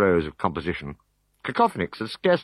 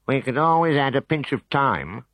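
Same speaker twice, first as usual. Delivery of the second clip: suggesting; 6 semitones higher than usual. Acted.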